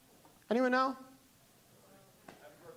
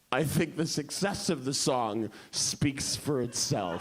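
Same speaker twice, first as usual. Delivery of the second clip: slow, lower, haughty understatement; lofty Mildred; 6 semitones lower, slower than usual. Retold or imitated. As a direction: say it with smug modesty. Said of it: "she went".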